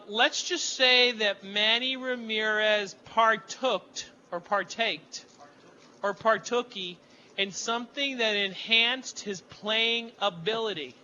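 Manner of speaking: slow, deliberate euphemism; drawn-out, tongue-in-cheek delicacy; mock-careful wording. Real, not acted. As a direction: pick each word slowly for comic tact. Choosing comic euphemism for doping.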